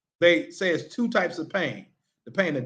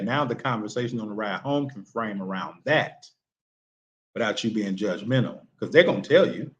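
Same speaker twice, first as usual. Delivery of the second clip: ordinary talk, nothing marked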